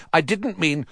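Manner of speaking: high, flustered protest; hurried. A narrator's reading. Nephew trying to calm his uncle, flustered. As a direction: start explaining, then get cut off. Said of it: "said Harry quickly".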